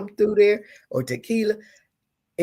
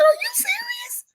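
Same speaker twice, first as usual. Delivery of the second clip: very high, disbelieving question; astonished. Retold or imitated. Woman retelling her reply to his proposal, astonished. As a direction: squeak it out in disbelief.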